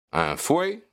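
high, rising surprised question; puzzled echo. Acted. Policeman asked about a whip he never saw, puzzled.

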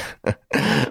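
short chuckle in character; amused little laugh; acted laugh. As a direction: laugh lightly before speaking. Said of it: "Ganimard laughed as he said".

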